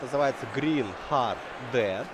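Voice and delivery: ordinary talk, nothing marked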